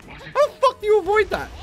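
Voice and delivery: very high, loud angry yell; furious shout. Real, not acted. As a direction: yell in outrage. Frustrated at boss attack.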